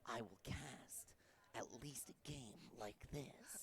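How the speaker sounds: soft, slow Solid Snake voice; slow, quiet stealth soldier deal; Snake impression. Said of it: "talk like that the rest of the stream".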